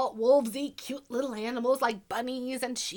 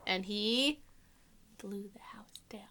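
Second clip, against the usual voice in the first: whispering; 6 semitones lower, 6 dB quieter than usual. A narrator's reading. Hushed dramatic.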